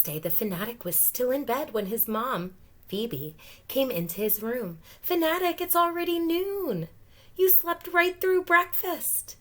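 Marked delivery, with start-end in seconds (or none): 5.0-9.4 high, loud mom calling her son awake; raised mom voice, loud and high; mom's voice. Acted. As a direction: call up the stairs like a mom. Mom waking son, character voice.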